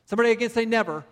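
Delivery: rally the congregation to answer back; call and response. Acted. Preacher calling congregation response.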